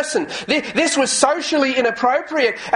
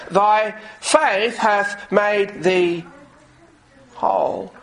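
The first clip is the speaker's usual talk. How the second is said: slow tender; much slower than usual. Acted. Preacher repeats Jesus' words slowly.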